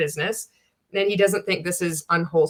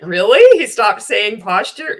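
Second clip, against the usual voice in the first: mock surprise; 8 dB louder than usual. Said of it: "you're like".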